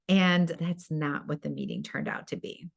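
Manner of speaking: ordinary talk, nothing marked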